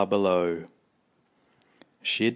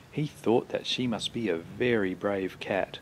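ordinary delivery, nothing marked

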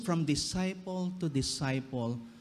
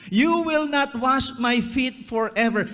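loud, high, insistent refusal; proud refusal; 7 semitones higher, 6 dB louder than usual. Acted. Preacher voicing Peter refusing Jesus, loud and proud. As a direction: push it like Peter's pride. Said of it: "Is Peter resisting Jesus".

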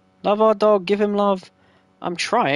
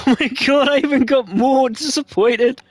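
laughing; 6 semitones higher, 5 dB louder than usual. Real, not acted. Laughing at the baby sim's face.

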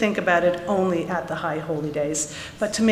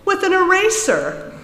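mocking teacher; 11 semitones higher, 4 dB louder than usual. A narrator's reading. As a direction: tease him in front of the class, pitched high. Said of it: "with a grin on her face".

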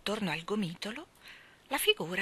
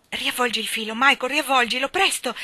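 urgent exclamation; 5 semitones higher, 8 dB louder than usual. A narrator's reading. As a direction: voice climbing higher and louder. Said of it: "esclamò Jane". (esclamazione impaziente, in crescendo)

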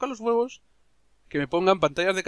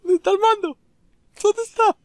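thin reedy voice; 6 dB louder than usual. Pretend. Answers in a squeaky voice.

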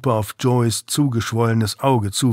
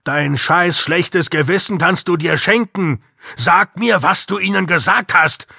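angry shout; 6 semitones higher than usual. Acted. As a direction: bark at the junkie to talk. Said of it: "Kessel schrie ihn an".

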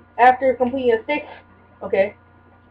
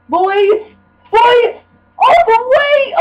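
high hype-up calls, building louder; excited cheer; 12 semitones higher, 4 dB louder, much slower than usual. Real, not acted. Cheering self on during the game.

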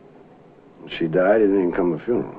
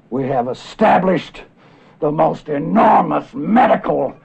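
raised, loud harangue, steadily building; mounting tirade; 7 semitones higher, 5 dB louder than usual. Acted. Doctor rails at what medicine has achieved, mounting tirade. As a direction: build from emphatic listing to indignant ranting.

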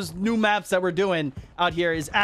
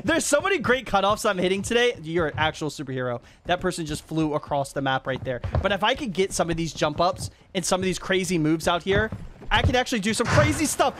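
usual speaking voice

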